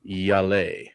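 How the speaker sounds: Yale in a put-on French voice; hint of fake French, drawn out; mock French accent. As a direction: say it "Yah-lay", mock French. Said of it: "let me get my French voice on".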